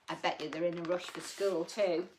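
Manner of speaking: plain reading voice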